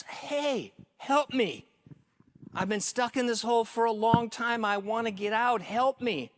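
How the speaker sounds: high-pitched pleading call; yelling for help. Acted. Trapped man to passing pastor, yelling high for help. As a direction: call up urgently to someone passing above. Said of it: "the guy yells".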